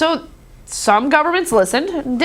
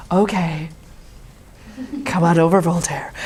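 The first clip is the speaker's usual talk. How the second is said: low mock hostess welcome; 10 semitones lower, 4 dB quieter than usual. Wealthy patron inviting Voltaire in, low and grand. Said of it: "Yep, go nuts".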